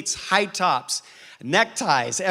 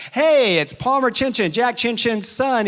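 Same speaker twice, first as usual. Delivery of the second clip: raised, higher voice calling into the intercom; eager loud call at the door; 7 semitones higher, 5 dB louder than usual. Retold or imitated. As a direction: announce yourself loudly up the intercom.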